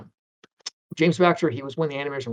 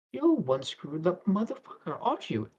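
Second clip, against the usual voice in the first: put-on British accent; 3 semitones higher, 4 dB quieter than usual. [name] reacts to Steve's portfolio, very British accent. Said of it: "in this very British accent".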